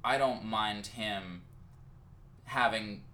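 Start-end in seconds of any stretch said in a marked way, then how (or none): none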